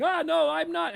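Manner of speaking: very high, panicky mock protest; haunted man. Steve objects to his haunting pants, high mock panic. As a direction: interrupt in a high, hammed-up wail. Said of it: "and then Steve is going".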